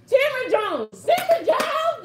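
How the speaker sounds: excited shout of the name. Real, not acted. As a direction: call the winner out loud and high. Congratulating winner, calling out name.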